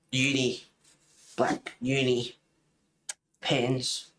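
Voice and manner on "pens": normal talking voice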